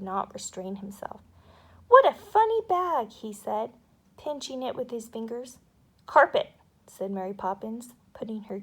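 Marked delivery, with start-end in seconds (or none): 1.9-3.1 put-on much higher, louder small-boy voice; little boy. A narrator's reading. Michael poking the bag, high and loud. 6.1-6.5 louder, crisp one-word correction; crisp nanny. A narrator's reading. Mary Poppins correcting Michael, crisp and loud.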